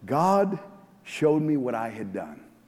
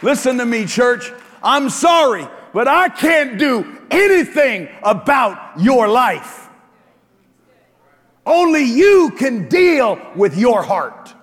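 higher, louder shouted preaching; preaching at full force; 10 semitones higher, 9 dB louder than usual. Acted. Pastor challenging the congregation, preaching full force. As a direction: drive it home to the church.

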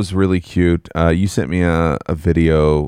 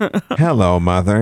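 Speak and Spell; much slower than usual. Acted. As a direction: greet like an electronic learning toy. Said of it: "She got a speak and spell".

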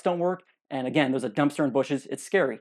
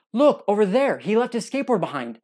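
high, loud imitated pointing out; mock-excited call of discovery; 7 semitones higher, 5 dB louder than usual. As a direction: coworker calling out the skateboard, higher and louder. Coworker points out skateboard.

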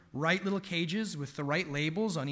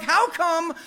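high, shouted wail of a jealous little one; toddler tantrum; 10 semitones higher, 4 dB louder than usual. Retold or imitated. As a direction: pretend to be a toddler crying out that it's unfair. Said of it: "he's like".

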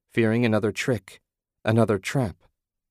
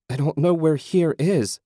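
higher, louder frightened outburst; distressed cry; 7 semitones higher, 5 dB louder than usual. A narrator's reading. Susan, lost and scared, crying out at the figures. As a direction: cry it out in panic. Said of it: "she cried".

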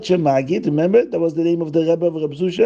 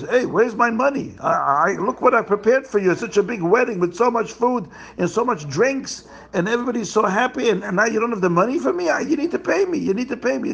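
higher, fast, pushy demand for payment; demanding caterer; 8 semitones higher, faster than usual. Acted. Caterer angrily demanding payment from the bride's father. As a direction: press him hard for the money, building to a high insistent pitch.

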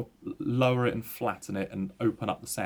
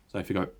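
throat clearing; much faster than usual. Shown as a demonstration. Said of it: "think about clearing your throat".

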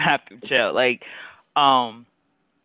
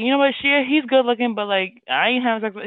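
hypothetical remark; 10 semitones higher, 4 dB louder than usual. Acted. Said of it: "You might be like".

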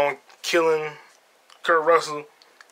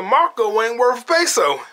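higher, slow punchline delivery; imitating Warren; 7 semitones higher, slower than usual. Retelling Warren's punchline to the theater, higher and slow. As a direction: savour Warren's put-down. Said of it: "He said".

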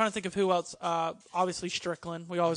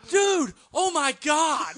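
much higher, louder fanboy shout; excited shout; 8 semitones higher, 7 dB louder, much slower than usual. Retold or imitated. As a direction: gush loudly like a starstruck fan. Omega thrilled at meeting.

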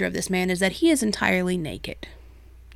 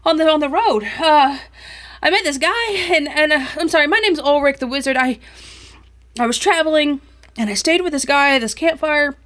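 higher, louder, tumbling words; excited stranger; 6 semitones higher, 5 dB louder than usual. Acted. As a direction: rush the story out. Stranger telling his tale.

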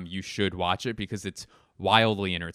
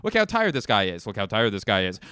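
ordinary talk

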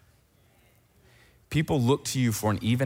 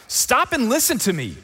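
scolding parent; 9 semitones higher, slower than usual. Retold or imitated. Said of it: "you've heard this saying before".

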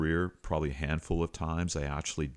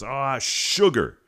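slow, drawn-out yell, much higher and louder; retold scream; 9 semitones higher, 9 dB louder, much slower than usual. Receiver yelling after his fumble, drawn-out shout. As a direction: bellow it out after fumbling the ball. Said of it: "screams out as loud as he can".

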